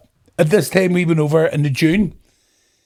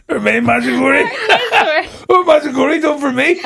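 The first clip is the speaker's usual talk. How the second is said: high, laughing comic lament; laughing hard; 12 semitones higher, 4 dB louder than usual. Real, not acted. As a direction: tell it helpless with real laughter. Laughing at his own comic tale.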